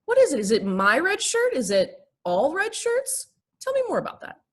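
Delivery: high, interested listener voice; curious counselor voice. Shown as a demonstration. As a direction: coax them gently to open up. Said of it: "if I'm, like, agitated about it".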